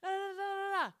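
high, loud babbling taunt; mock trash talk. Stomping upstairs talking trash to cousin, high babble. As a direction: babble nonsense insults while stomping off. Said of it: "I'm like".

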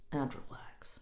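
whispered. Real, not acted. Calming after fuss.

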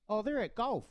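tossed-off casual reply in a put-on voice; mock offhand reply. Voicing the reply at home.

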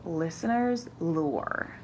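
slow, drawn-out title announcement; dramatic announcement. Shown as a demonstration. Naming the new segment.

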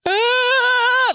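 high sustained "ah" squeal; high-pitched cry of delight; overwhelmed squeal. Real, not acted. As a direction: let out a long, very high squeal. Overcome by the photographer's gift.

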